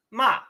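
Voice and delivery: Ma said with a sharp falling fourth tone; 第四声. Shown as a demonstration. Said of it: "dropping accent".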